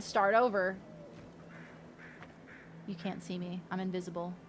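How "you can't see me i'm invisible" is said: much softer, hushed and sly; sneaky hush. Real, not acted. Gamer sneaking past mobs, speaking in a hush. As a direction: keep it down as if the mobs could hear.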